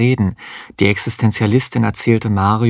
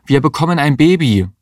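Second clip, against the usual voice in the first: urgent call; 6 semitones higher than usual. A narrator's reading. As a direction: insist on passing. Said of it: "rief Mario". (drängend, lauter)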